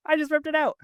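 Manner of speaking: high laughing retelling of the same line; laughing repeat. Real, not acted. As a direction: laugh it out, voice jumping up.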